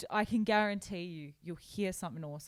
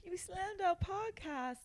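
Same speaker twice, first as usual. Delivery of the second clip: high-pitched playful protest; mock outrage; 10 semitones higher, 4 dB quieter than usual. Mock outrage at slammed podcast.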